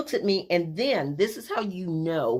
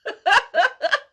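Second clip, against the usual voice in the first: high laugh; 7 dB louder than usual. Real, not acted. After near miss.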